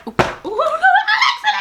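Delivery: high laughing shout; startled laughing shout. Real, not acted. Reacting to Alexa interrupting.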